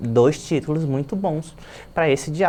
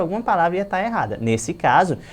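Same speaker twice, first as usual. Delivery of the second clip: usual speaking voice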